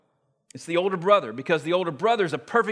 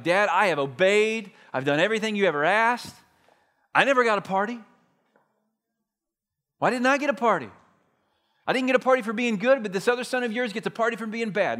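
put-on whiny older brother; 6 semitones higher than usual. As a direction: whine it like a wronged kid who got no party. Older brother whining to father.

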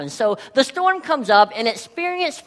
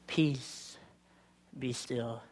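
calm command; 10 semitones lower, 15 dB quieter, much slower than usual. Acted. Jesus rebuking the sea.

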